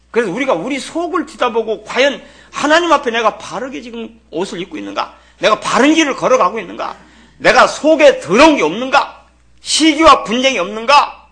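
preaching that climbs to a loud peak; rising sermon. Acted. Preacher to congregation, questions building to loud fervour. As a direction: press each question harder on the church.

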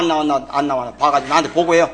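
ordinary talk, nothing marked

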